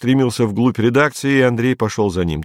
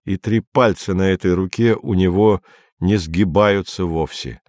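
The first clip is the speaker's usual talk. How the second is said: plain reading voice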